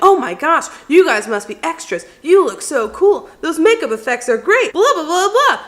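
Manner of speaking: high, loud, gushing excitement; imitating an excited stranger with a laugh; excited fan. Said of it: "So excited".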